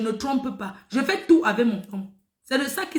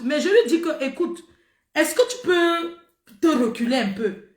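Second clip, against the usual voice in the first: usual speaking voice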